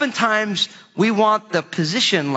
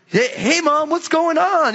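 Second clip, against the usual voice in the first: put-on young [name]; 8 semitones higher than usual. Young [name] greets his mom.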